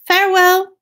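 high, loud, breezy goodbye; high-voiced departing mouse; mouse's farewell. A narrator's reading. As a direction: call out a high little farewell.

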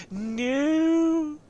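long, high, loud comic howl; panicked wail. Acted. Gamer caught out by the enemy destroyer, high panicked wail. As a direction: stretch it into a squealed protest.

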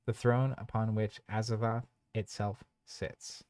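measured storybook narration; narrator voice. Acted. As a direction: read it like a dark tale. Reads game scenario text.

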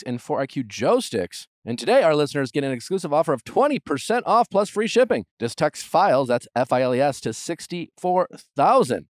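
polished promo announcer delivery; podcast ad read. Acted. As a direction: sell it clearly, every web address letter distinct. Reads sponsor ads.

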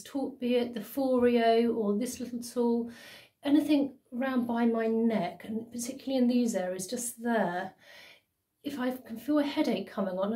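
talking with mask on face; wearing a sheet face mask. Real, not acted. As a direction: while rolling neck massager. Seen 2: Wet sheet mask covers face throughout; mouth opening left uncovered.

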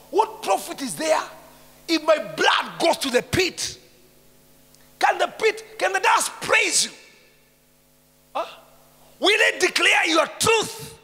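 loud high desperate cry to God; pleading with God. Retold or imitated. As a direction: shout the psalm as a plea. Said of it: "He's asking God".

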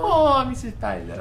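very high, put-on little kid; mimicked child voice. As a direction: squeal it like a needy child. Joins in the child voice.

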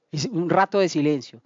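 normal talking voice

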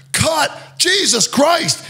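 high, slow mock shout; hammed-up furious director yell; imitating angry director. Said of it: "he's just, like, going".

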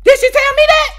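high, loud incredulous question; mock disbelief. Comedian retelling her snub, high incredulous disbelief. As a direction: act shocked she said it.

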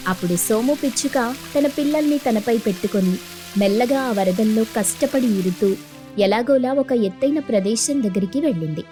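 plain reading voice

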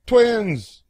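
high, loud sudden twins; spring the surprise; surprise reveal. Acted. Child reveals the twin costumes, loud and high.

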